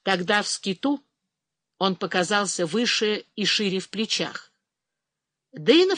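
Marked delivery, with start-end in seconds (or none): none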